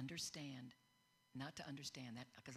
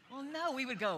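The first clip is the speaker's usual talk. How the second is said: ordinary delivery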